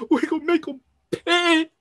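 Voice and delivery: loud, high, raging oath; furious vow. Acted. Squad member vows revenge for Kenji, loud furious shout.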